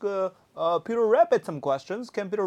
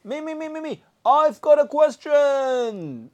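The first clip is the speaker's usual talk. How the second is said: eager Pooh voice; 7 semitones higher, 7 dB louder than usual. Pretend. Voicing eager toy Pooh.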